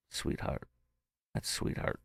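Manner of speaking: soft, choked-up whisper; emotional whisper. Real, not acted. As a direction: barely voice it, moved. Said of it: "I'm getting a little emotional".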